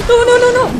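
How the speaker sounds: high, loud string of no's; panicked screaming. Real, not acted. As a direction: scream it in real alarm. Dying in game, panic. (gritos de pánico)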